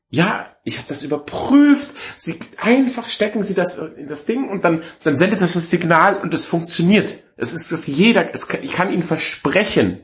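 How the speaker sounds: high-pitched, emphatic sales assurance; imitated rep overselling her promise; insistent saleswoman. Saleswoman voiced insisting it works.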